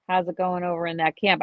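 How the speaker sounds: ordinary talk, nothing marked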